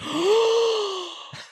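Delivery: very high hammed-up gasp; mock horrified gasp. Host imitating the plumber gasping at the Drano.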